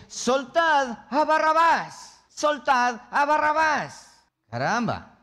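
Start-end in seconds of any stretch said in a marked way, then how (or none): 0.1-4.1 high, drawn-out mob chant; raised, stretched-out cry of the mob; crowd shouting as one. Acted. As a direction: shout it slowly like a demanding crowd. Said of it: "a una sola voz dice".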